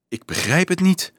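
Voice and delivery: put-on a scared girl not understanding; anxious, high and uneasy; frightened voice. A narrator's reading. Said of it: "zei Frida met angstige stem".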